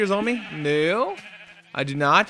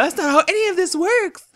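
meme quote; 13 semitones higher, 3 dB louder than usual. Retold or imitated. Meme quote, high far voice.

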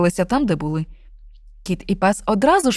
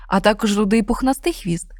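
plain reading voice

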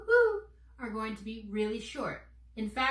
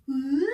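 a louder playful whoop; silly whoop; 2 semitones higher, 6 dB louder than usual. Shown as a demonstration. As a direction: switch the imagination on with a silly noise. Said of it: "with a silly noise like this".

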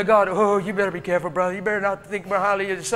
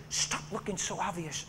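softer, hushed warning from the passenger seat; 3 semitones lower, 10 dB quieter than usual. Retold or imitated. Woman in truck warns preacher, hushed. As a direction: keep it down, like you're afraid of being seen. She warns him to be discreet.

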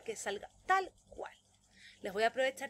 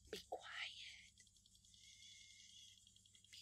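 whispered "Be quiet"; whispered command to be quiet; 22 dB quieter, much slower than usual. Shown as a demonstration. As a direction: hush the class in a whisper. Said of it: "lo exagerada que soy".

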